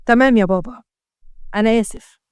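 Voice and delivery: subdued, mildly sad apology. A narrator's reading. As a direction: downcast son, understated. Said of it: "حط وشه في الأرض بحزن".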